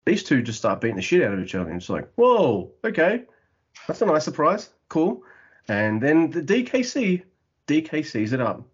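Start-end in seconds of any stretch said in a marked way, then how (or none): 2.2-5.2 raised, mildly impressed reaction; a touch of surprise, pitched up; pleasantly surprised. Retold or imitated. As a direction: react as if caught off guard in a good way. Reacting to surprisingly hard fighting.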